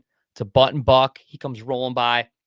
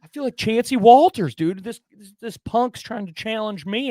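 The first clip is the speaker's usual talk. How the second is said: much higher and louder, fired up; hyped bragging; 9 semitones higher, 9 dB louder than usual. Retold or imitated. Hunter hyping himself up after calling in the buck, high and loud. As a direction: yell it like a champion.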